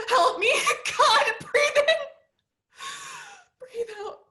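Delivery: building to a loud, high, laughing cry; escalating panic. Acted. Molly losing control in a panic attack, loud and frantic. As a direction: spiral from strain into hysterical panic. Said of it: "breathing of a panic attack".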